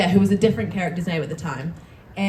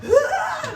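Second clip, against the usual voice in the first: high, sharp mock scream of joy; excited high yelp; retold scream. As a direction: let out a squeal, re-enacted. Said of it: "screamed outside of the supermarket".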